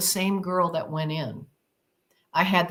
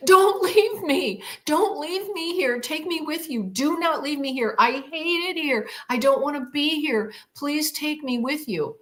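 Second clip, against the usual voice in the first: desperate pleading; 7 semitones higher than usual. Retold or imitated. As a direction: beg the craft not to leave you. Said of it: "it's like it wasn't me".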